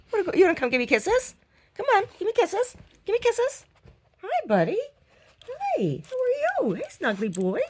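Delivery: high, cooing pet voice. Real, not acted. Asking the dog for kisses in high baby talk.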